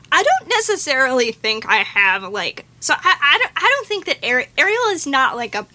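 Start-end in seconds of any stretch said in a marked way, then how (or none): none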